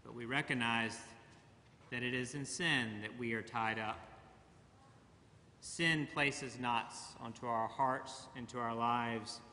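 usual speaking voice

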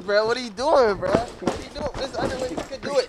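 loud protest. Real, not acted. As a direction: push back hard and loud. Coworker objects loudly.

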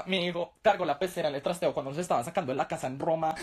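mother's hammed-up pride; proud mother. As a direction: brag grandly about a tiny feat. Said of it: "mi mamá puede decir".

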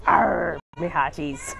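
lightly voiced pirate greeting; pirate talk. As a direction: growl the arr like a playful pirate. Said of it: "how to talk like a pirate".

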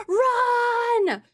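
panicked yell of run; panicked shout. Real, not acted. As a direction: shout it out in alarm. Fleeing the boss in panic.

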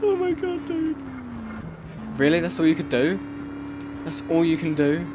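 high, disbelieving oh my god; incredulous. Real, not acted. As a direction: scoff at the useless flying boat. Mocking the weak flying boat.

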